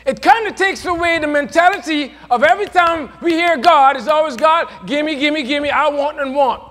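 mocking gimme in sermon voice; full-force preaching. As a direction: drive it home to the congregation.

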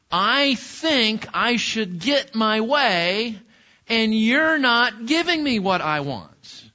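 slow, high, demanding whine; selfish complaint. Retold or imitated. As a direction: drag it out like a spoiled child. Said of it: "what it's saying is".

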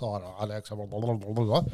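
low, soft mumbled blah-blah; mocking the boss. Boss reporting Alex to her superior, low mumbled mockery. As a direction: skim through the complaint under the voice, dismissive.

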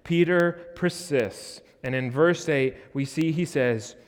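ordinary talk, nothing marked

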